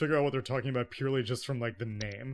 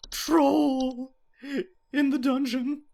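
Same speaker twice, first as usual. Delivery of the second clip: high, loud panicked alarm; panicked shout; 13 semitones higher, 7 dB louder, much slower than usual. Acted. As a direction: scream it like raising the alarm. Sudden alarmed movie-quote shout.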